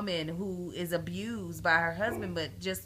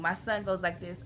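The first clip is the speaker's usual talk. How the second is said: ordinary delivery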